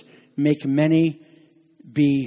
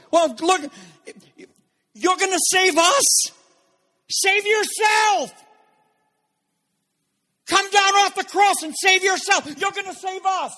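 jeering mockers. Mockers shout at Jesus on the cross, jeering. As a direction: shout it mockingly like a crowd at an execution. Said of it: "What did they say when Jesus was on the cross?".

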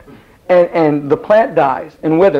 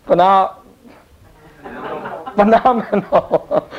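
laughing stumble; 3 semitones higher, slower than usual. Real, not acted. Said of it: "I'm sorry".

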